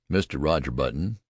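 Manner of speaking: ordinary delivery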